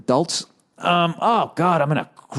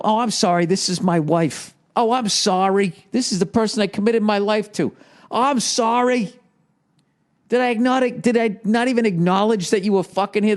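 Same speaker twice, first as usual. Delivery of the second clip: sarcastic mock apology; 9 semitones higher than usual. Husband mocking how wives get introduced at parties, sarcastic and high. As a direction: rub it in, higher each time.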